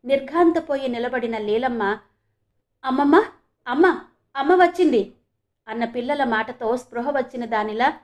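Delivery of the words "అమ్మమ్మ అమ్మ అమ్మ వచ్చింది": higher, louder eager cry; excited announcing. A narrator's reading. Children tell grandmother that mother has come, excited cry. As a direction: burst out with happy news.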